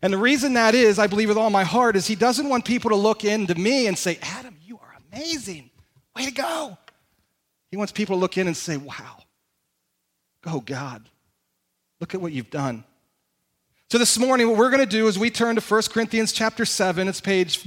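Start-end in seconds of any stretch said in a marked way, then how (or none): 4.2-6.8 softly gushing praise with swoops in pitch; mock admiration. People looking at the preacher and praising him, mock admiring. 8.8-12.8 soft, low, wondering praise; hushed awe. Retold or imitated. People looking in and marvelling at God, in hushed awe.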